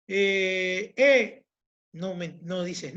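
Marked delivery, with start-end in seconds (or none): 1.0-1.4 a lone vowel “e”, said short and loud; bare sound e. Shown as a demonstration. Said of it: "si yo te digo".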